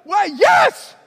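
emphatic insistence. Retold or imitated. Jimmy insists loudly.

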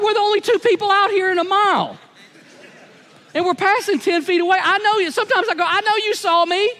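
high, incredulous mock complaint to a passerby; exasperated plea. Pastor mock-pleading with park walker who won't wave back. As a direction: playfully call out the one who ignored you. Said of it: "And I go".

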